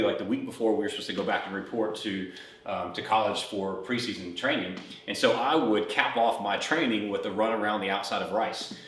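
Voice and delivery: ordinary talk, nothing marked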